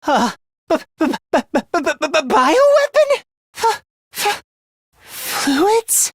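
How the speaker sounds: high, panicked stutter; shocked stammering. Acted. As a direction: stumble on the first sounds, pitch leaping up.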